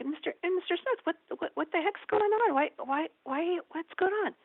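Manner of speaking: high-pitched flustered questioning; stammering, high, retold concern; acted alarm. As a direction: imitate a nurse startled by a distressed patient. Finds patient sobbing, acts alarm.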